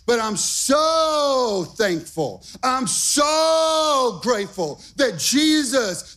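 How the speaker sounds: soaring gospel preaching over the music; full-force preaching. Acted. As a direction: lift the room with joyful preaching, half-singing. Preacher builds to full-force praise.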